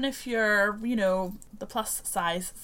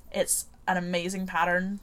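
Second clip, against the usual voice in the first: normal talking voice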